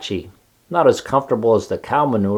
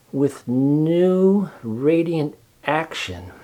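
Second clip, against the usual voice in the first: very slow, thoughtful repeat, a shade higher; 4 semitones higher, 4 dB quieter, much slower than usual. Acted. Charlotte musing over the ad, very slow. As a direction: savour the words slowly, thinking. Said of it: "repeated Charlotte slowly".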